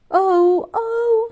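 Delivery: high, loud startled oh-oh; alarmed oh-oh. A narrator's reading. Girl realizing the baby is upset, high alarmed. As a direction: catch yourself in trouble. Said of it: "said Elizabeth".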